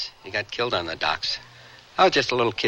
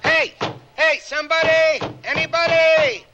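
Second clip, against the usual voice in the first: shouting for help. Acted. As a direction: yell up the shaft to be heard. Guard shouting for help trapped.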